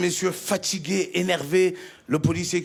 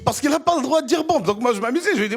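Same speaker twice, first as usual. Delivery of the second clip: ordinary delivery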